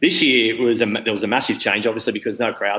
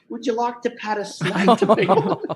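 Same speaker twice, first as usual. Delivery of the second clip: higher, laughing mock-chirpy offer; chirpy reptile handler; 10 semitones higher than usual. Reptile keeper offering snakes to the crowd, chirpy and higher. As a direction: play the keeper cheerfully hawking snakes mid-show. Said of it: "they're like".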